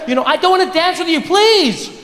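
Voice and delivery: high-pitched, pleading refusal; exasperated woman. Acted. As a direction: play a fed-up woman begging to be left alone. Acted woman firmly refusing the creep.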